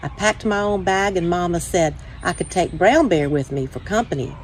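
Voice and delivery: ordinary delivery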